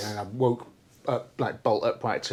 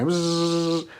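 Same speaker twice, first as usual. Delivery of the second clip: imitated welding buzz; 6 semitones higher than usual. Imitating welder noise.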